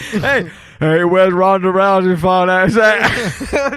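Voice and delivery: loud, slow, drawn-out holler; hammed-up shouted impression; shouted fighter quote. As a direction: holler the catchphrase across the room. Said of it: "These are all quotes".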